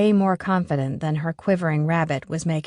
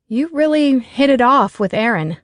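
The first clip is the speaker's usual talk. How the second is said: pointed; 6 semitones higher, 6 dB louder than usual. A narrator's reading. Allie pressing Finn.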